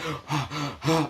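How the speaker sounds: sharp rising gasp of alarm; panicked gasp. Retold or imitated. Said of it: "she starts panicking".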